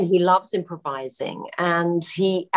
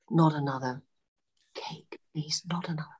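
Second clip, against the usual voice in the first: a much softer imitation of a tired plea, fading almost to nothing; weary plea; 3 semitones lower, 5 dB quieter than usual. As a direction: plead wearily, letting the voice die away at the end. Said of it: "was really tired".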